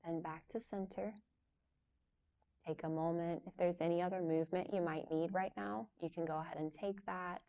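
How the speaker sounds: soft, unhurried yoga teacher voice with long pauses; guided yoga instruction. Real, not acted. Yoga teacher leading a class through gentle stretches before relaxation, calm guiding voice. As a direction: guide them softly through each stretch, leaving room to move.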